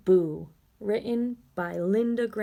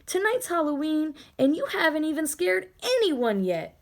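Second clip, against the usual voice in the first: put-on higher, teasing older brother; a brother urging Ben on, higher and teasing; big brother ghost; 7 semitones higher than usual. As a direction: nudge him along, a bit higher. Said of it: "he said".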